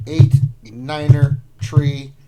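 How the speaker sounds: slow, loud radio operator read; military radio voice. Pretend. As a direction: call out the digits like a radio operator. Reading code like military radio.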